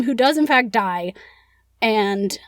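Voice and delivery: ordinary delivery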